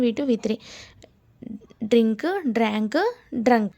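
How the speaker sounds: ordinary talk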